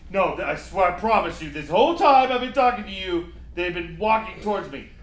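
high, loud desperate insisting; frantic. Acted. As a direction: plead urgently, near hysteria. Frantic caller insisting plants walk.

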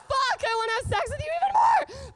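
high, loud frustrated shout; horny outburst. Retold or imitated. Told he won't have sex, a high loud lusty shout. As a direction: yell it like desire just doubled. Punchline outburst at his request.

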